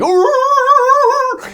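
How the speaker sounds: a sharp high yelp like a barking dog. Retold or imitated. Retelling the dog barking at the fire, imitated high bark. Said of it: "He was like".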